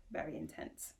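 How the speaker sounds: breathy deadpan whisper under the story; whispered aside. Acted. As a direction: drop to a hushed whisper for the joke.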